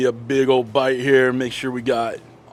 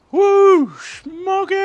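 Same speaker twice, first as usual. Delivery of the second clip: much higher, loud joyful whoop; excited whoop; 9 dB louder, much slower than usual. Real, not acted. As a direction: cheer at the smoke rolling in. Excited as smoke rolls.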